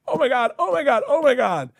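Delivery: high-pitched, imitated gushing over an idol; star-struck fan. Wife meeting her favorite actor, imitated star-struck.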